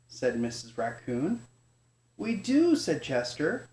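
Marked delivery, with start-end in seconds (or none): 2.2-2.8 high, loud eager cub voice; eager yes. Acted. Chester's eager reply.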